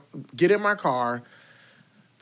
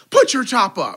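loud, high barked order; mock shouting; 7 semitones higher, 8 dB louder than usual. Yelling about the convertible top after the accident, mock shouting. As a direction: yell it like a heckler.

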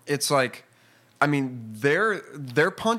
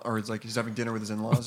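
normal talking voice

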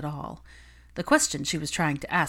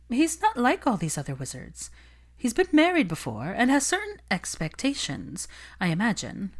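higher, confiding gossip voice; knowing socialite; 6 semitones higher than usual. Acted. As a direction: let her in on the secret. Olivia analyzes Draco.

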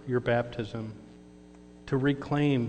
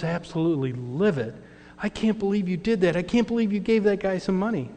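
livid outburst; 6 semitones higher than usual. Retold or imitated. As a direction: blurt it out in outraged shock. Keith livid after giving money.

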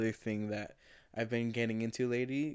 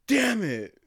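mock frustrated curse; 9 semitones higher, 5 dB louder than usual. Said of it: "he was just like".

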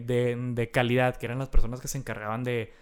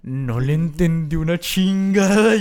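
slow, drawn-out disbelief from a baffled coworker; 4 dB louder, much slower than usual. Retold or imitated. Coworker reacting to a call he couldn't follow, slow stunned disbelief. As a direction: stretch it out like you understood nothing.

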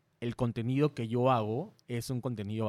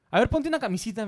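higher, louder mock of the friend giving orders; imitating the director friend; 9 semitones higher, 5 dB louder, faster than usual. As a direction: boss him around like a cheeky director, pitched up. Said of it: "me dice". (imitando al amigo que da órdenes, más agudo y fuerte)

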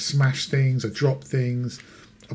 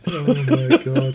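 laughing; 3 semitones higher, 8 dB louder, much slower than usual. Real, not acted. Listener cracking up at story.

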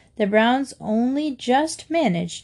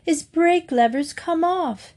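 much higher-pitched alarm; alarmed exclamation; 8 semitones higher than usual. A narrator's reading. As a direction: blurt out bad news, high and urgent. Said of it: "exclaimed Jonathan".

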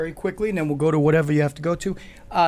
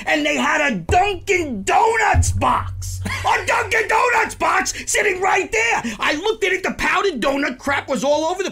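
excited; 13 semitones higher, 5 dB louder than usual. Real, not acted. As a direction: drag it out in loud mock disbelief. Mock outrage about donut box.

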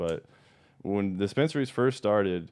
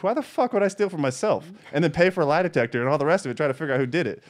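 retold indignant protest; 7 semitones higher, 4 dB louder than usual. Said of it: "And I was like".

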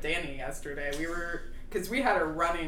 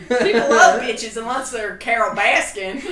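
loud Tiger King rant; 4 semitones higher, 10 dB louder than usual. Acted. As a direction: rant like Joe Exotic. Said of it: "Our Tiger King voice".

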